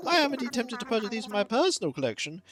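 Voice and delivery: lilting, high-pitched courteous scholar; fussy museum host. Acted. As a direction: appraise grandly as a fussy expert.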